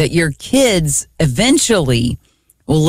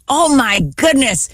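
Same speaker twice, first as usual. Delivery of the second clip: mock-exasperated daughter, pitched well up; frustrated; 7 semitones higher than usual. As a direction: whine it like a fed-up youngest child. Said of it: "she got frustrated".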